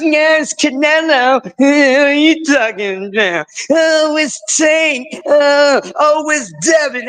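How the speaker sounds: high, loud whiny fan voice; mocking fans. Host imitating boxing fans crying, high mock whine. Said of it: "Everybody's crying about".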